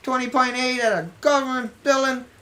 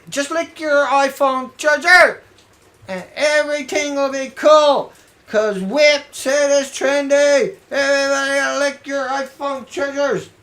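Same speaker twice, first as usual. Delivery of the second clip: sarcastic mock advice; 3 semitones higher, 6 dB louder than usual.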